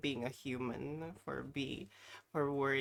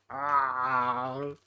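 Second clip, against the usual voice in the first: a big strained "ah" with a mouth full; loud strained groan; 3 semitones lower, 8 dB louder than usual. Real, not acted. Stuffing six chips in at once, groaning loudly.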